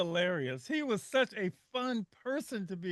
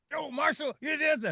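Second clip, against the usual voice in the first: stern elder voice; 6 semitones higher, 4 dB louder than usual. Retold or imitated. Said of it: "what would he say?".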